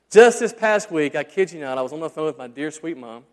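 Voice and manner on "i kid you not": usual speaking voice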